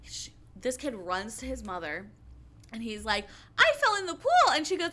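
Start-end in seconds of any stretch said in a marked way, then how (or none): 3.6-4.5 put-on much higher, louder little-kid voice; imitating a small kid. Retelling how the kid ran to his mother, in a high little-kid voice. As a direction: pipe it up like a toddler telling on himself.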